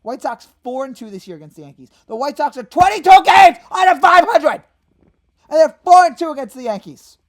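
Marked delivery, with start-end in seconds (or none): 2.0-7.2 loud, higher disbelieving yell; louder, higher fan outrage; outraged shout. Real, not acted.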